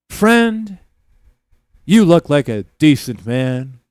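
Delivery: loud, high, grandly declaimed hard-luck plea; hammed-up street orator pitching a dream; homeless storyteller. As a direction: proclaim each phrase loudly, with pauses between. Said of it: "there's a homeless guy, you get a story".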